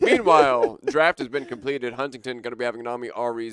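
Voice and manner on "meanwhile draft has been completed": loud announcing. Real, not acted. Announcing draft results, loud.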